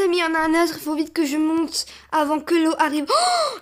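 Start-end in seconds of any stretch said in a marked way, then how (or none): 3.1-3.6 very high shocked gasp; startled gasp. Real, not acted. Player spotting another wave coming, startled gasp. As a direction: gasp sharply in alarm.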